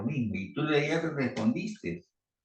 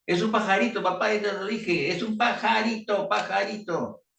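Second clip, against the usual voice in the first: exasperated son; 8 semitones higher than usual. Acted. Son answering father, getting fed up.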